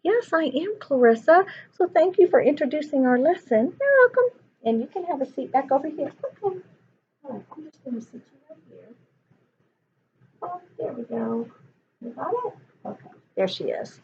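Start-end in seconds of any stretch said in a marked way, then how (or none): 3.8-4.4 very high squeaky puppet voice; tiny high-pitched puppet character. Acted. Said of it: "She's gonna talk to you guys". 4.6-14.0 much softer, quick murmured aside; quietly settling the puppet down; hushed aside. Real, not acted.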